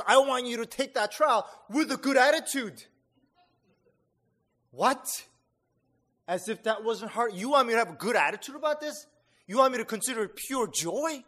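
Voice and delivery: mock outrage. Mock indignant objection to God.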